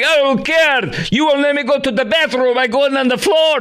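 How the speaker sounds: high stubborn woman refusing to wait; mock defiant passenger. Host mimics the passenger refusing to hold it, high and hammed-up.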